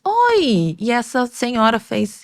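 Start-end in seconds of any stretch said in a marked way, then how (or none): 0.0-0.8 bright, much higher mock-surprised greeting; a startled, much higher hello. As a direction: fake a cheerful hello when caught off guard. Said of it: "Aí eu virei e fiz".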